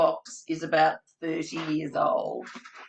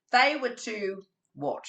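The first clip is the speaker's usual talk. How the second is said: lively read-aloud for little ones; reading to children. Acted. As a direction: tell it like storytime with small kids. Reading Dear Zoo to children.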